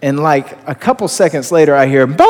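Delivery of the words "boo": a short mock dog bark; imitated bark. Pastor imitating the blind dog barking, short mock bark.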